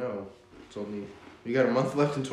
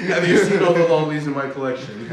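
laughing; 4 semitones higher, 10 dB louder than usual. Real, not acted. Teasing, laughing mid-line.

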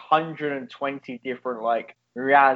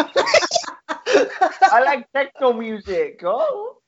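put-on high, slow, laughing German accent; 11 semitones higher, 3 dB louder, much slower than usual. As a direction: squeak it out in a silly German accent. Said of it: "You sound Swedish".

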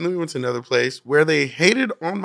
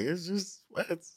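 much softer, slow disbelieving mock; exasperated aside; 4 semitones higher, 16 dB quieter, much slower than usual. Retelling friends scoffing at the bouncers, soft slow disbelief. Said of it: "And everybody's like".

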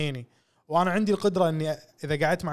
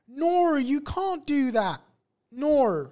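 much higher, very slow Australian nor with a faint r; Australian accent; 10 semitones higher, much slower than usual. Shown as a demonstration. Host acting an Australian telling someone off: high, slow Aussie voice. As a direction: play a scolding Aussie teacher. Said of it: "يحط حرف ال R آخر شيء".

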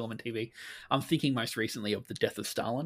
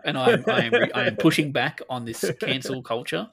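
mock outraged pundit; 3 semitones higher, 7 dB louder than usual. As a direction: play a pompous commentator railing against cancel culture, half laughing. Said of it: "we're going full Joe Rogan".

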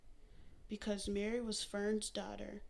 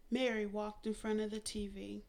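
ordinary delivery

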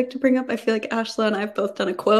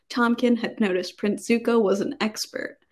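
usual speaking voice

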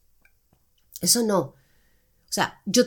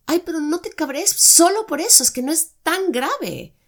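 high, loud, whiny imitation of a patronising ex; mock the ex brushing her off, pitched way up; mocking dismissive ex; 9 semitones higher, 6 dB louder than usual. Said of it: "Entonces me decía".

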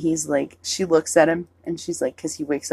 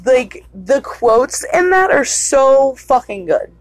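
excited; 7 semitones higher, 5 dB louder than usual. Real, not acted. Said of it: "enthusing about film".

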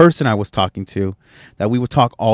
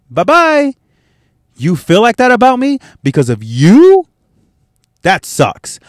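high, louder sing-song bye-bye in a put-on voice; mock farewell; 8 semitones higher, 4 dB louder than usual. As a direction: wave them off in a sneering cartoon voice.

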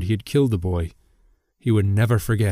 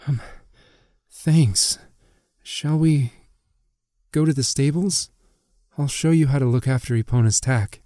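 lightly voiced, higher-pitched girl; hesitant, recovering politeness; halting thanks, pitched up a little; shaken farm girl; 5 semitones higher than usual. A narrator's reading.

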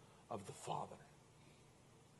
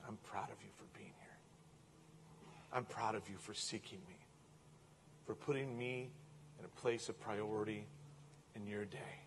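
softer, almost whispered reassurance; tender whisper; 4 semitones lower, 12 dB quieter than usual. Acted. God tells the seeker he is proud, in a soft hush. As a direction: murmur it like a father's comfort.

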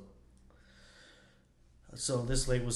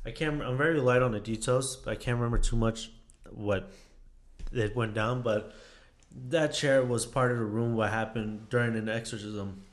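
ordinary talk

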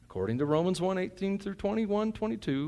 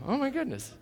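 laughing; 11 semitones higher, 5 dB quieter than usual. Real, not acted. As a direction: chuckle through it. Laughs at himself.

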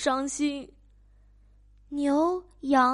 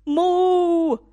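high, loud mooing cow; 牛大声哞叫; 4 semitones higher, 8 dB louder than usual. Acted. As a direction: bellow it like a cow. Cow chases farmer.

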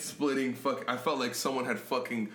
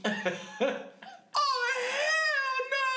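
very high, mock outraged cry; outraged dad; slower than usual. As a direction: shriek like an appalled father. Dad walks in.

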